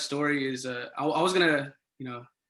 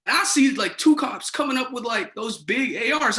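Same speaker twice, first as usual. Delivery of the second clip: ordinary delivery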